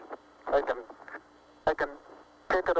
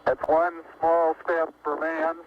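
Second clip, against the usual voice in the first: measured, slow declaration; each word placed slowly and solemnly; slow and deliberate; slower than usual. Real, not acted. Historic first step statement.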